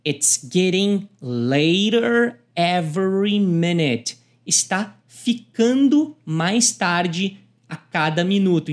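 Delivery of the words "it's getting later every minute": slow "It's getting later every minute"; slow teaching demo; slow model line. Shown as a demonstration. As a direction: spell it out slowly. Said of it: "o B diz".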